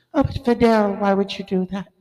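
high, coy woman's voice; Barbara Walters voice. Retold or imitated. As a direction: play coy interviewer.

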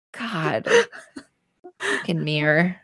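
ordinary talk, nothing marked

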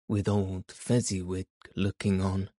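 ordinary delivery

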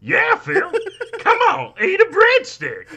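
very high, loud, laughing through it; goofy high impression breaking into laughter; John C. Reilly voice. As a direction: squeal it through giggles. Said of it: "Reilly in The Sopranos".

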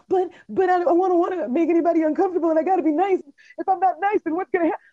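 high, fast, fretful inner voice; anxious people-pleaser. Retold or imitated. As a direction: whine it like a worried pleaser. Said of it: "sitting here like".

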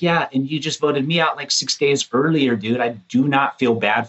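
high, snarky sarcastic reply; sarcastic retort. Retold or imitated. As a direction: throw it back snidely. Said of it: "I was like".

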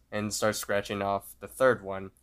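ordinary delivery

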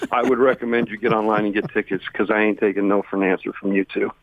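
ordinary talk

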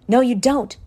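usual speaking voice

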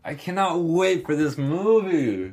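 real high, drawn-out excitement; pumped up. Real, not acted. Host reacting to the horror movie clip, pumped up. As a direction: gush slowly and high, thoroughly pumped. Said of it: "That gets me so pumped".

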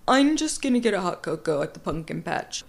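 lightly mocked man with "punkin" for pumpkin; questionable man voice. Man ordering cocoa at the patch, questionable man voice. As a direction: put on a dubious guy voice. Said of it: "My man voice".

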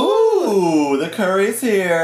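high, loud, delighted "ooh"; excited call. Real, not acted. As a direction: call out that the food has arrived. Excited call as food delivery arrives.

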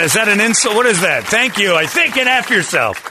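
high, loud confused outrage; mock indignant. Retelling being called Vin Diesel, indignant. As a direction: bristle at a backhanded insult.

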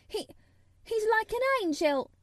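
high, loud, impassioned; fervent defence. A narrator's reading. Mary praising Dickon to Colin, fervent. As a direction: gush it in defiance.

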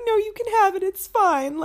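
high, loud, wobbly fake-sob voice; mock crying. Child giving brother the cup, mock crying high and loud. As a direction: whimper it like a kid giving in through tears. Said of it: "I remember crying and being like".

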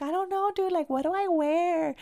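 high-pitched whiny mock fretting; imitating fretting friend. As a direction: voice a friend fussing, pitched well up. Acted quote of fretting friend.

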